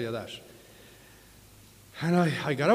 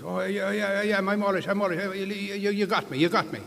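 leprechaun; 7 semitones higher than usual. Acted. Leprechaun voiced in joke.

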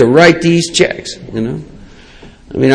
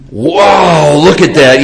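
stoner-like amazement; 3 semitones higher, much slower than usual. Retold or imitated. Newly sober shopper gaping at grocery aisles, amazed. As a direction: marvel like a dazed stoner. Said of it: "were pure [name] and [name] stuff".

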